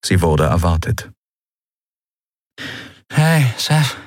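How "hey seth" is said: higher, strained murmur; battered murmur. A narrator's reading. Beaten Endriel greeting Seth, murmuring. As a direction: murmur through bloody lips. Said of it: "murmelte sie mit blutigen Lippen".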